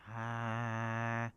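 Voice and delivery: sustained steady "ah" hum; long monotone drone imitation; imitated background hum. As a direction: hold one even tone like room noise. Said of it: "Anyways, it's like".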